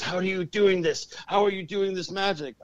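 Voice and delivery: imitating a bewildered, alarmed opponent; freaked out. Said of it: "it freaked her out".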